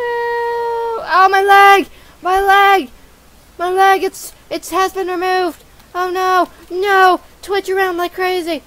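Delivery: high, loud hammed-up wailing; mock agony. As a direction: scream over a lost leg, cartoon-style. Character loses a leg.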